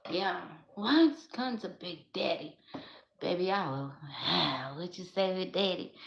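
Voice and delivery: usual speaking voice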